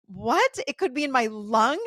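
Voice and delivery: loud, rising alarmed question; loud disbelief at the dentist's news. Retold or imitated. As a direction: fire the question back in fresh panic.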